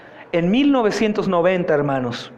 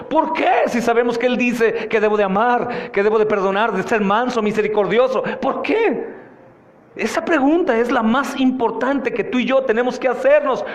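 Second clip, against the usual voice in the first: high, insistent pulpit questioning; urgent, raised-pitch sermon delivery; impassioned preaching; 6 semitones higher than usual. Acted. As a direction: hammer each question at the congregation. Preaches at full force.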